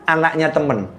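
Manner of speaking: normal talking voice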